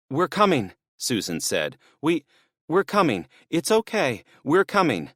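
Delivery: trying to calm everyone down, scared; frightened placating. Acted. Susan trying to calm the men, frightened.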